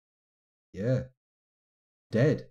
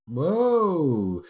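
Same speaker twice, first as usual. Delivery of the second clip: surprised whoa; 5 semitones higher, 7 dB louder than usual. Real, not acted. Surprise at artifact creation.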